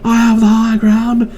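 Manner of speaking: high, drawn-out mock-heroic cry; Obi-Wan impression. Obi-Wan warns Anakin off from above, high and drawn out. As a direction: call it out high, stretching the words. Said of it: "Obi-Wan doesn't have a lightsaber".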